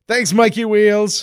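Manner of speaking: high squeaky thanks; high mock thanks.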